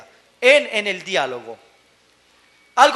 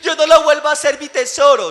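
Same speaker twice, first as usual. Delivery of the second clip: apologetic parent; 5 semitones higher, 6 dB louder than usual. Retold or imitated. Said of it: "otros que le piden perdón".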